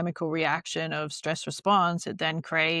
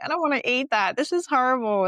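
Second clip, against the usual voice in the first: put-on whiny kid; 6 semitones higher, 4 dB louder than usual. Kids complaining about dinner.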